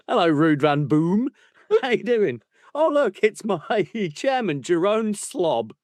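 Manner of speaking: high-pitched hammed-up greeting; mock introductions. Mimicking book characters meeting the chairman, hammed-up introduction. Acting out silly Dutch-name introductions.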